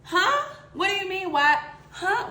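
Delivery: high, incredulous huh; baffled. Retold or imitated. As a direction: sound totally baffled. Baffled reply to rude passenger.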